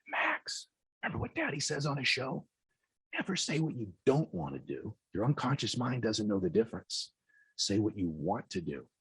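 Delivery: quiet, hushed coaching to his son. Retold or imitated.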